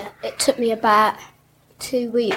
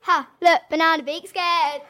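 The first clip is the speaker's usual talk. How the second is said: put-on young Simba; 5 semitones higher than usual. Shown as a demonstration. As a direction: taunt like a cheeky lion cub. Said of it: "you can have your line now as, as Simba".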